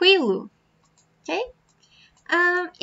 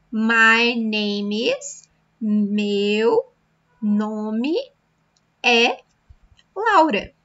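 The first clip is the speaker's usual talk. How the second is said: slow model phrase; much slower than usual. Shown as a demonstration. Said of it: "teacher modelling phrase slowly".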